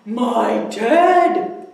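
loud, far-off bear's gasped cry. Acted. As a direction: gasp as the bear sees its teddy. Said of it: "gasped the bear".

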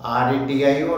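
ordinary delivery

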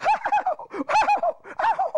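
high mock whoops of relish; three high, put-on gleeful cries; imitated gleeful hollering. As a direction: holler it out as if getting a kick out of it. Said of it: "like he was getting a kick out of it".

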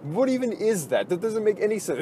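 usual speaking voice

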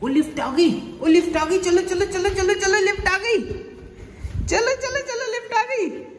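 rising high, building excited call. Real, not acted. Lift arrives, hurrying kids. (Lift आने पर उत्साह से ऊँची पुकार)

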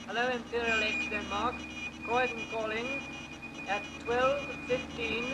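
usual speaking voice

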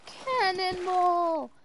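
high drawn-out yell; cannonball yell. Real, not acted. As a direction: yell it long as you jump. Diving again.

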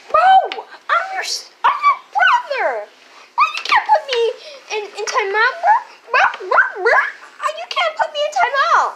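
very high squeaky protest; squeaky kid voice. Pretend. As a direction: squeak it out, laughing. Brother voice protests timeout.

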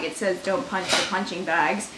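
low, soft, dry correction; deadpan reminder. Retold or imitated. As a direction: point out the rule quietly and dryly. Deadpan pointing out the sign.

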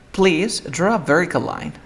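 "vertical" said without T. Shown as a demonstration. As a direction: model sentence with vertical as "ver-ical". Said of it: "Dirías".

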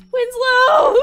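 long, high shout of a name; anguished call. Pretend. Player calling lost worm, melodramatic shout. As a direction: wail it out in mock despair.

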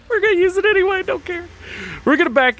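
very high squeaky voice after a laugh; amused. Real, not acted. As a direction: squeal it out, tickled and defiant.

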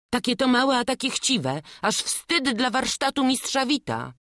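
put-on a resentful journeyman sneering at the boy; jealous journeyman. Jealous journeyman complains to master.

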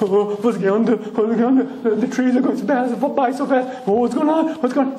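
a comic fright that climbs as the car speeds up; panicked Shmuel. Acted. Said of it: "I begin accelerating onto the highway".

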